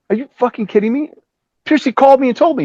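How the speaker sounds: high-pitched indignant protest; retold disbelief. Bassist protesting that Piercy called him, incredulous.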